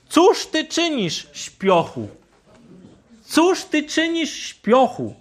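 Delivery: high, urgent call to a sleeper; helmsman's shout. Acted. Helmsman shouting at sleeping Jonah, high and urgent. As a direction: wake him up sharply, pitched high. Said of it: "Krzyknął jeden z pasażerów".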